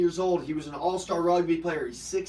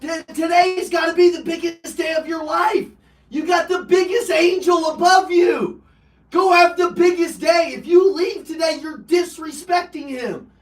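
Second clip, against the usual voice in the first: high, loud, fierce retold shout; grandma shouting; 12 semitones higher, 9 dB louder than usual. Grandma shouting at her grandson to have the biggest day. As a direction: bark orders to get him out the door. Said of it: "She's yelling at me".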